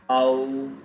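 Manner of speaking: long, held au in au; drawn-out au sound. Shown as a demonstration. Teacher showing learners how A U sounds, drawn-out au. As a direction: say au as one long steady gliding vowel. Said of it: "pronounced as".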